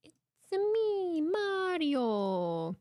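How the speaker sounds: high, drawn-out cartoon catchphrase; playful video game hero call; Mario impression. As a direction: sing out the greeting, sliding down from high.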